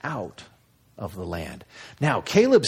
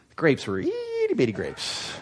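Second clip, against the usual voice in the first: mock belittling; 5 semitones higher, 3 dB quieter than usual. Spies belittling the fruit.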